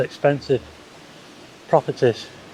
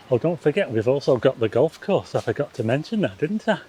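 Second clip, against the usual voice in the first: usual speaking voice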